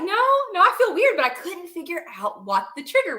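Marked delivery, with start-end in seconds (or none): 0.0-1.2 high, slightly louder worried protest; imitating your own rising panic; retold alarm. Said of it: "I was like".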